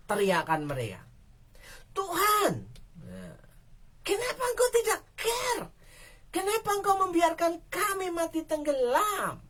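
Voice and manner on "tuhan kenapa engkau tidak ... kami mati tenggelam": tearful desperate accusation; panicked cry. Acted. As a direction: scream at the sleeping master in terror. Disciples' panicked cry voiced.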